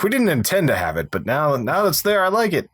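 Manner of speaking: high, rising put-on voice; mock director. Director pretending the smoke plume was intended, in a high mock voice. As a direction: play a chuffed director who now loves the accident.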